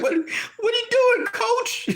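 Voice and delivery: very high, squeaky mock confusion; bewildered falsetto. Wilder at his corner, mock bewildered falsetto. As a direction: play Wilder stunned and lost.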